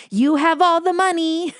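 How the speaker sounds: high-pitched mock shout; high, hammed-up holler; retold shout. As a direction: imitate yelling out of a car window.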